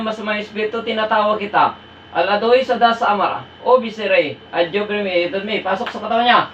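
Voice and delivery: low, steady Latin-like incantation; low-pitched exorcist's invocation; ritual incantation. Real, not acted. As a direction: recite the summoning prayer low and unbroken. Recites a summoning incantation.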